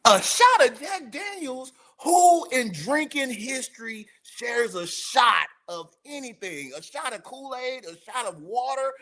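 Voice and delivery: high, loud outraged disbelief; a shot of Jack Daniels, can you believe it; his look turned into an outraged rant; incredulous. Retold or imitated. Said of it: "that look said".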